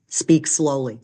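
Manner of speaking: ordinary talk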